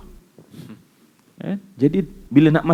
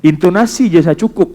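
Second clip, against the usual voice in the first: ordinary delivery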